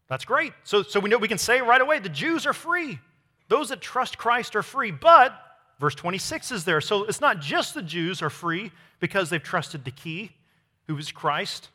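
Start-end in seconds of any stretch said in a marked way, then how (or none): none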